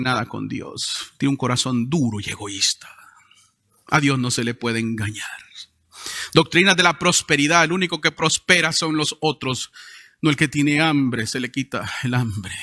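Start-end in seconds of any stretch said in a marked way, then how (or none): none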